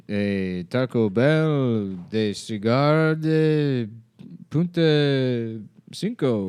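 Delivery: mock Spanish. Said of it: "what is he gonna do".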